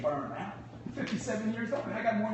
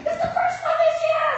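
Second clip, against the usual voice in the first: very high, loud, laughing cry; mock celebration, much higher and louder; excited shout; 8 dB louder than usual. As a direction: shout it like a proud announcement. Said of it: "What you supposed to say".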